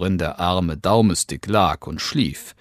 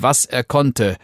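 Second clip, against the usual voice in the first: usual speaking voice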